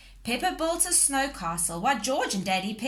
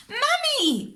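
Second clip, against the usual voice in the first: very high, loud call; crying out; 7 dB louder than usual. A narrator's reading. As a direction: cry out as the food flies. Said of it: "cried Peppa".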